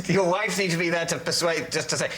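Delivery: ordinary talk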